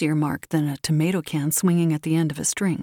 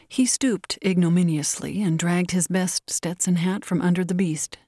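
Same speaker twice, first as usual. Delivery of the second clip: usual speaking voice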